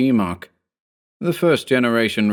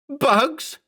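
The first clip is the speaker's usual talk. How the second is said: much higher, rising shriek; shrieked question. A narrator's reading. Mark shrieks the word back at the clone. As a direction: near scream of disbelief. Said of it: "Mark practically screamed".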